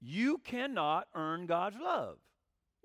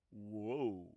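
mock amazement; 10 semitones lower, 7 dB quieter than usual. Mock astonished listener.

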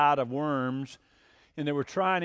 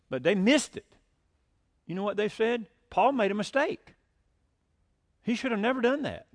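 fiery preaching; 6 semitones higher than usual. Acted. Pastor rebuking a critic of Paul, fiery preaching. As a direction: preach it with mounting outrage. Preacher builds to indignant peak.

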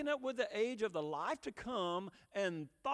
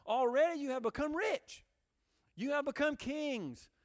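raised pitch, mock-congratulating sarcasm; sarcastic; 6 semitones higher, 3 dB louder than usual. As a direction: congratulate them sarcastically in a lifted voice.

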